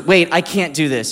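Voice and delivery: mock protest.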